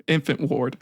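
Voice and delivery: ordinary delivery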